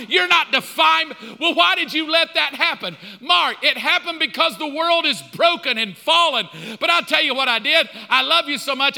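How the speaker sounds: loud, high, urgent preaching; impassioned divine rebuttal. Acted. God insisting to Mark his past is washed, impassioned. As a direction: plead at full force, driving every point home.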